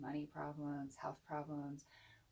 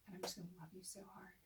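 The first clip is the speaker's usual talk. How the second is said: soft, fast whisper; tender whisper; 7 dB quieter, faster than usual. Retold or imitated. Son at his mother's bedside, a tender whisper. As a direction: whisper it close, full of love. Son tells mom he loves her.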